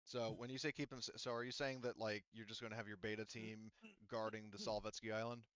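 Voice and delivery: usual speaking voice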